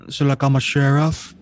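lightly voiced basketball star; Shaq. Co-host attempts Shaq for his friend, drawling impression. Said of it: "one I was thinking was Shaq".

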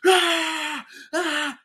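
high, loud mock scream; mock war scream. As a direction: yell like a soldier under fire. Imitating soldiers screaming in war.

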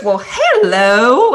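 high, loud, drawn-out Southern greeting; Southern accent. Retold or imitated. Mom greeting a hiding kid, imitated Southern accent. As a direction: imitate a Southern lady, stretching it out slowly. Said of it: "I can't even do her Southern accent".

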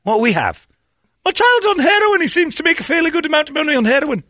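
shrill, very high frantic wail; hammed-up hysterical parent; mock Scottish panic. Scots losing their allowance, hysterical high-pitched mock panic.

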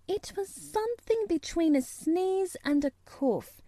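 put-on high-pitched prim little girl; high, severe child's reply; reproachful dignity. A narrator's reading. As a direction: answer with wounded, proper dignity. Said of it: "she replied with reproachful dignity".

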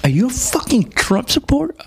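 high, indignant mock accusation; accusing stranger. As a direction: fire the question like a scandalized stranger. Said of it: "I remember somebody asking me that".

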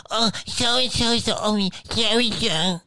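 high whiny mock voice, pitched well up; mocking desperate people. Mocking desperate people seeking approval, high whiny mock voice. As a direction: mimic a fawning hanger-on. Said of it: "coming in and going".

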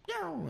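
high-pitched zooming "yow"; quick squeal for something shooting up; high, soft imitated whoosh; imitated takeoff noise. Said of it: "and then it just went".

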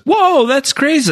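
squeaky high mock gasp of amazement; mock surprise. Padme hearing news, mock astonished high voice. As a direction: play Padme wide-eyed and shocked. Padme reacts in retold scene.

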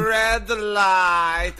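high, singsong chant; giant doll's game call. Acted. Co-host plays the game's giant doll, high singsong call. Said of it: "so she was like".

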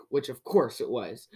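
ordinary delivery, nothing marked

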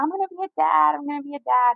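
high-pitched imitation of elated announcing; mock excited scream. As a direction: act out crowing to everyone, without full volume. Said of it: "He starts screaming to everyone".

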